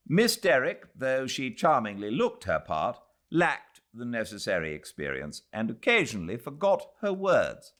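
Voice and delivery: lightly voiced newspaper critic; quoted notice voice. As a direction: read the critic's line with prim relish. Newspaper notice read aloud.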